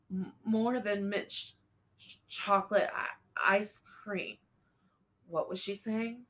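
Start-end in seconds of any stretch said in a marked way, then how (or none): 0.1-4.4 stuttering breathy fragments; fading, broken whisper; faltering whisper. A narrator's reading. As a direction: struggle to get the words out. Dying mother stammering whisper.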